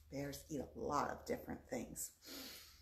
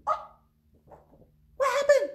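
loud high shocked wolf; surprised wolf; 17 dB louder, faster than usual. Acted. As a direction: shriek it out, baffled. Wolf surprised house stands.

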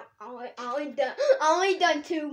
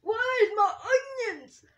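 Shrek voice; 9 semitones higher, 8 dB louder than usual. Retold or imitated. Kids doing Shrek impressions.

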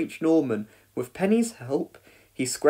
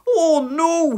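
high, loud wail of dismay; distressed cry; 13 semitones higher, 7 dB louder than usual. A narrator's reading. Boy trapped upstairs by smoke, distressed cry. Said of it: "he cried".

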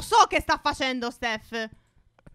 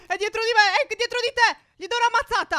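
higher, frantic panicked cries; being chased; 7 semitones higher than usual. Real, not acted. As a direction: panic as the killer closes in. Killer chasing them in game.

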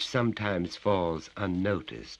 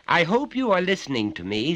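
lecturing father, raised and higher; stern father; 13 semitones higher, 8 dB louder than usual. Acted. Father lectures his little son, stern and raised. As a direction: talk down to the child from on high.

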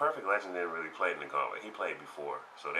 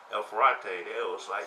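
usual speaking voice

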